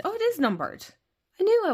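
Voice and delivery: rising, eager surprise on "is"; surprised discovery. Real, not acted. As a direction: realise it with delight: "it IS numbered". Discovers the deck is numbered.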